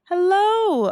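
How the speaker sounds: high, loud bright hello; Miss Peanut greeting. Acted. Miss Peanut greeting Opal at the bakery. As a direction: greet her warmly. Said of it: "said Miss Peanut".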